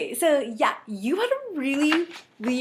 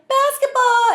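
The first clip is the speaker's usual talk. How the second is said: excited call; 11 semitones higher, 5 dB louder, slower than usual. Retold or imitated. Kids calling out game.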